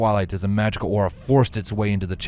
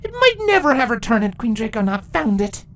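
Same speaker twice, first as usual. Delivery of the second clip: higher, louder heated objection; defiant Twilight; 6 dB louder than usual. Acted. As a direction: push back hard. Twilight arguing back.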